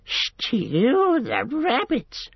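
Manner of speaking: very high, drawn-out creature squeal; dismayed squeal. A narrator's reading. Gollum hearing of stewed rabbits, squealing in dismay. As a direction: squeal it slowly in horror. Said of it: "squealed Gollum in dismay".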